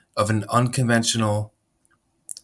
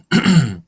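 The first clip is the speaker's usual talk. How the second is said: throat clearing; 5 semitones higher, 7 dB louder than usual. Real, not acted. Throat clearing before new age.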